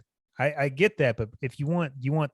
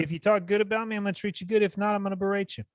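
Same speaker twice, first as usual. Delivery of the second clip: higher, cocky imitation of a trash-talking fighter; mock Colby attitude; 8 semitones higher than usual. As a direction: lay out the rules like an arrogant champ. Speaking as Colby to reporters.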